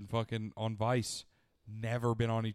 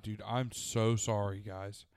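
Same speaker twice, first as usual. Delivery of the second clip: ordinary delivery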